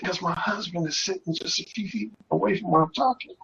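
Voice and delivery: soft, hushed imitation of the caller; whispering. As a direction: keep your voice down, husband is near. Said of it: "she started whispering".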